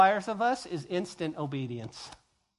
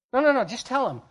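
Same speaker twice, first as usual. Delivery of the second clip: louder, higher no no no; insisting; 6 semitones higher, 6 dB louder, faster than usual. Acted. Abel insisting to his friend, louder. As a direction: brush the objection off.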